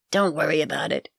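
a hint of an irritated small dog's growl; mild growl of a tiny irritated woman; growled like an irritated Pekingese. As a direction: lightly growl it, small and testy. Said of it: "growled the tiny woman in a voice like an irritated Pekingese".

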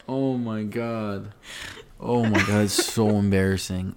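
ordinary talk

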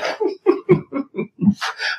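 breathy high-pitched giggle; tickled laugh at own joke. Real, not acted. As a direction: laugh at the punchline.

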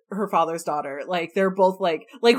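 ordinary talk

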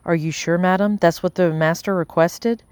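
plain reading voice

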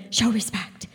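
hissed whispered warning; urgent whisper. Acted. Mother whispering a warning to Citra, hushed and urgent. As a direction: whisper it sharply under your breath.

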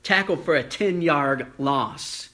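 ordinary talk, nothing marked

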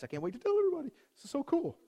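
high-pitched, giddy enthusiasm; excited reaction. Retold or imitated. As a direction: act out being thrilled with news. Voices own excited reaction.

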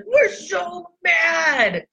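put-on high, slow, childish cry of "so mad"; hammed-up kids' angry shout; mock angry kid shout. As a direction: shout it like a pouting little kid. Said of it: "they're like".

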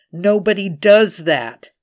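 loud, stressed "does" falling; shouting. Acted. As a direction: shout "does" hard and let it drop. Refrain repeated, loud take.

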